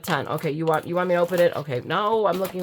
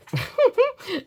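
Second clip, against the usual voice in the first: short, very high giggle; squeaky little titter at yourself; sheepish giggle. Real, not acted. Giggles after apologising for dopiness.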